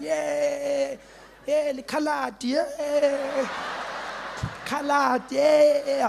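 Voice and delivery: high, laughing gleeful singsong; happy kid. Retold or imitated. Kid skipping down the road, high gleeful crowing. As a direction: skip along crowing with joy.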